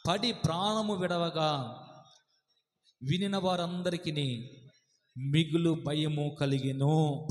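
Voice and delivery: slow, solemn Bible reading; solemn slow scripture recital. Acted. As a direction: read each phrase slowly and gravely. Reads scripture slowly.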